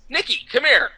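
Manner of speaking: high, playful summons; calling someone over. Retold or imitated. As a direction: imitate calling a colleague across the room. Retold actor calls colleague over.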